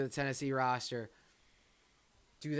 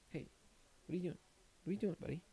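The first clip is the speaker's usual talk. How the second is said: talking to the dog; 5 semitones higher, 8 dB quieter, much faster than usual. Real, not acted.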